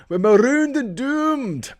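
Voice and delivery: high, slow, hammed-up Scottish wail; a terrible Scottish impression of a doomed soldier; bad Scottish accent. Vlogger hams up a Dad's Army catchphrase in a Scottish accent. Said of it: "I won't try and do a Scottish accent".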